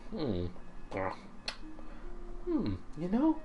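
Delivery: a hint of a girl moved by mom's cooking; lightly voiced girl, scornful then wistful.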